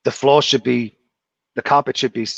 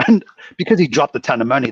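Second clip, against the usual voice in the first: ordinary delivery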